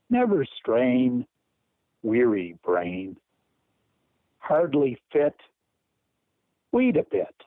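measured verse in short, separated phrases; poem recital. Acted. Caller reading Advice to a Young Author, poem recital. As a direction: pause after each little line, letting each rhyme land.

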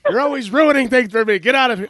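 high, loud mock outburst at the husband; playful high-pitched shout; mock shrill yell. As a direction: squeal it out in a hammed-up shriek. Mock quarrel voicing the poet.

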